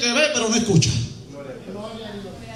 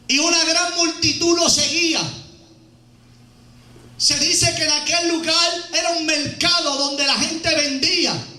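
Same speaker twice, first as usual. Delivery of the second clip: full-voiced preacher's storytelling; preaching; 4 semitones higher, slower than usual. Preacher tells the congregation about Bartimeo, preaching.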